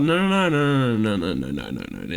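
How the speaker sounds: hammed-up dismay fading away; reply to a bad photo, dropping into despair; mock horrified denial. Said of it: "And I was like".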